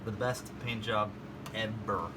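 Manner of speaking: dry, low-key sarcasm; sarcastic. Real, not acted. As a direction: praise it while meaning the opposite. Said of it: "That is sarcasm".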